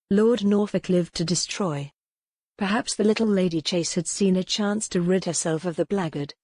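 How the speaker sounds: plain reading voice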